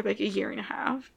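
unvoiced soft aside; whisper. Real, not acted.

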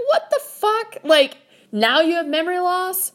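high-pitched, exasperated disbelief; incredulous. Retold or imitated. Incredulous at his claimed forgetting.

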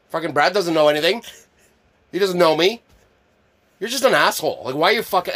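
loud, high-pitched mock outrage; feigned offence. Prankster confronting his buddy over the push-up question, feigning angry offence. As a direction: pick a fake fight, indignant and loud. Staged fight with buddy in gag.

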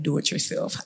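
God's whisper. Retold or imitated. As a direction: breathe it like a still small voice. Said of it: "God was literally like".

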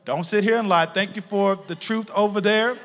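usual speaking voice

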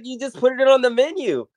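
very high, squeaky disbelief; mock-outraged squeak; incredulous protest. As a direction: act out being baffled by the cashier. Retelling her own protest to worker.

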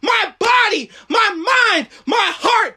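shouted high preaching climax; roaring rallying speech. Acted. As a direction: roar it out like a revival. Climax of sermon, shouting declarations.

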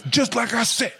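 high put-on gloating voice; mocking gloat. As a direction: sneer like a smug winner. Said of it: "they always gotta do this".

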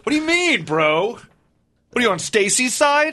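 high-pitched put-on outrage; squeaky incredulous bro, drawn out at the end; mock indignant. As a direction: play an offended boyfriend challenging a stranger. Playing the driver confronting the passenger.

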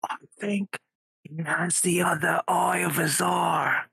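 hushed whisper to a friend; whispered aside to Moira. Acted. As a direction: lean in and whisper it secretly. Nudging Moira, secret aside.

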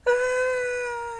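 high, drawn-out wail; reenacted high sob; mock crying wail. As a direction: imitate bursting into tears. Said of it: "I just started crying".

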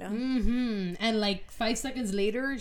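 ordinary talk

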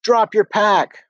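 very high, loud and slow yelled command; desperate shout. A narrator's reading. Narrator screaming at Rafaela to drop her pack. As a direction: stretch the words out, screaming across the woods. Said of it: "I shouted".

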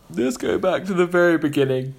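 yawning mid-sentence. Real, not acted. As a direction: talk through a yawn. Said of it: "sorry, I'm yawning".

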